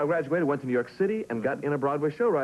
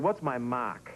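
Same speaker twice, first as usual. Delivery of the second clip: put-on New England accent, mark as "mahk" with the R dropped; old New England accent; 4 semitones lower, 4 dB quieter, much slower than usual. Recalling a seventh-grade question in class, New England accent. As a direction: drop the R in "mark", New England style, a bit lower. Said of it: "I changed my accent right away".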